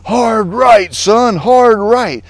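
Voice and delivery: high, loud barked command over the radio; instructor shouting. Retold or imitated. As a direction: yell the order up to a falling jumper. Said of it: "Johnny goes".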